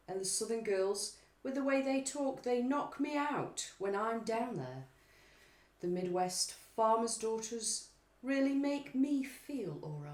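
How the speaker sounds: slow, lilting poem recital; poetry reading. Pretend. Co-host reads pop lyrics as if reciting Keats. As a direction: recite it like a romantic poet. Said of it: "In the style of Keats".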